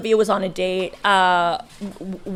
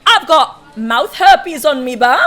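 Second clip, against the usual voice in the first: loud, drawn-out mock British punk shout; 5 semitones higher, 7 dB louder, slower than usual. Friend re-enacting her heckling of the date, ridiculous loud yell. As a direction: belt it out slowly, higher, like an obnoxious punk. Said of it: "Like, so loud".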